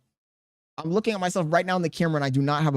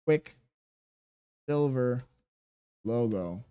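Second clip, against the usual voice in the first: drawn-out name; 5 semitones lower, 5 dB quieter, much slower than usual. Shown as a demonstration. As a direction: stretch Quicksilver very slowly, syllable by syllable. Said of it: "repeats the name drawn out".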